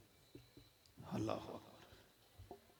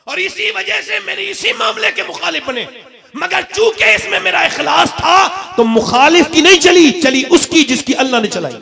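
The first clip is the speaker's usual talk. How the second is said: raised sermon voice building loud; full-force preaching; 9 semitones higher, 13 dB louder than usual. Acted. Preacher answering his opponents before the congregation, high and increasingly loud. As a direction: drive it up to a forceful declaration.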